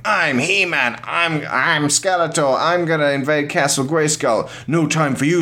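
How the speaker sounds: mock boy's play-fight voice; boys play fighting. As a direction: kid playing He-Man and Skeletor. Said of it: "little boys ... going".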